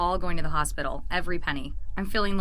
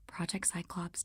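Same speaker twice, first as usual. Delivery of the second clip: normal talking voice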